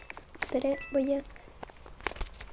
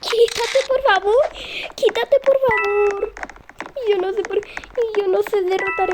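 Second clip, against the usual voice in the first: high, louder whiny plea; pleading whine; 5 semitones higher, 4 dB louder than usual. Real, not acted. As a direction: beg him to move, high and louder. Suplica que se aparte. (súplica aguda y fuerte)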